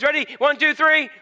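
usual speaking voice

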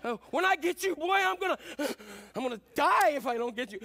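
loud, high mock threat while out of breath; winded threat. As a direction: act out a dad gasping threats mid-chase. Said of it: "totally out of shape, running".

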